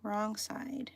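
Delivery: "wrong side" whispered as she reads it off. Real, not acted. Crafter whispering the pattern step to herself. Muttering instructions while reading pattern.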